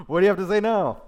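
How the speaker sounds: very loud, high-pitched jeer; exaggerated taunting inflection. Zebul jeering at Gal, hammed-up loud taunt. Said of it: "That's my, my inflection".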